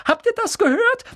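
squeaky, very high, loud boast; beside-himself little man; excited cry. A narrator's reading. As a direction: announce it to the whole room, overjoyed. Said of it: "rief Daedalus Dickel und blickte in die Runde".

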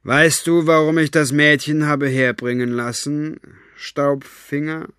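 put-on smooth, cold villain addressing a captive girl; a lightly voiced, silky tyrant; villain mocking a frightened girl; menacing Capricorn. A narrator's reading. Capricorn calls to prisoner.

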